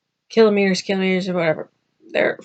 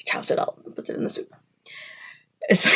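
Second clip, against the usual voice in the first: normal talking voice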